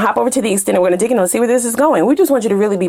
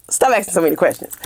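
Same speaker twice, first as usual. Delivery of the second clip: snapping back; 4 semitones lower, faster than usual. Acted. Answering back the complaining person.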